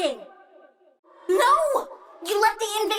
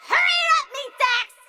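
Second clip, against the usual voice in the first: impatient yell; 7 semitones higher, faster than usual. Acted. Fed-up plant keeper yelling at meat sacks. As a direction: snap at them to move.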